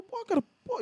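retold high-pitched disbelief; shocked protest. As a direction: play the stunned young man caught off guard. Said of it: "Aí eu".

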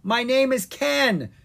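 high, loud, drawn-out protest; shouting. A narrator's reading. Wizard correcting the crowd, shouting. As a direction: shout to be heard over cheering. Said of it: "Ken shouted".

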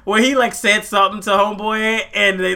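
ordinary talk

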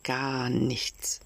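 ordinary delivery, nothing marked

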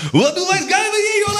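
high shrieking mock yell; imitating a yell. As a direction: squeal it out, hammed-up. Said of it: "Der schreit immer so".

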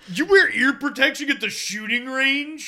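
high, loud incredulous question; hammed-up scornful disbelief; mocking taunt. Mocker questioning shooter's ear protection, loud high taunt.

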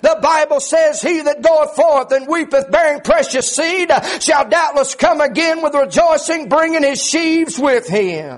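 driving, rhythmic preacher's testimony; sustained high preaching voice, phrase after phrase; full-force preaching. Acted. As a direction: pour it out in urgent revival cadence.

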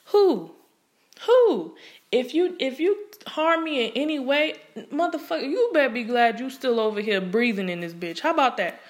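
high, loud, indignant shouting; furious outburst. Real, not acted. Defending abused children, shouting in real fury. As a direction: erupt in outrage at abusers.

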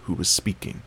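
usual speaking voice

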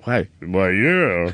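low, slow man's voice; lightly voiced deep rich voice; deep celebrity voice. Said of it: "What a voice he had".

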